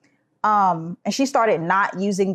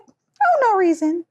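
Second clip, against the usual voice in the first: very high, fake-innocent little voice; innocent tease; 11 semitones higher than usual. Little sister feigning innocence, very high fake-innocent voice. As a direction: play dumb sweetly. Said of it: "She was like".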